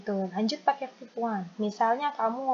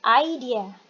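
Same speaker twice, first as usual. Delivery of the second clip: "idea" said quickly as one word; faster demo; 4 dB louder than usual. Shown as a demonstration. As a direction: model "idea" briskly for learners. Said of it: "Yo, faster". ("idea" diucapkan lebih cepat)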